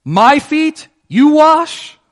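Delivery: high, loud, incredulous Peter; an indignant squeal of disbelief; emphatic Peter. Retold or imitated. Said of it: "he's emphatic about it when he says it".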